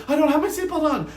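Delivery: fast, high panicked yell; Pep yelling from the back seat in panic. A narrator's reading. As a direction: blurt it out in alarm, fast and high. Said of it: "Pep yelling".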